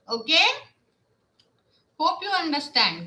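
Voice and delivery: usual speaking voice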